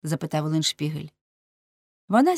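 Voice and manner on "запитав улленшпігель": ordinary delivery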